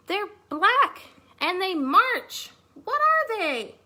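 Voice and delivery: high, loud, slow mouse voice in wonder; amazed little mouse. Acted. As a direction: gasp into it as a startled tiny mouse.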